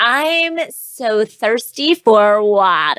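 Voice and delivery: very slow, drawn-out mock of a hint dropped on purpose; mocking imitation. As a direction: stretch every word like a pointed hint. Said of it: "she was just like".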